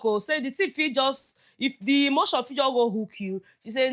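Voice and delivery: normal talking voice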